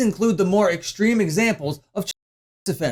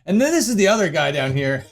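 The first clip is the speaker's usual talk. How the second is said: normal talking voice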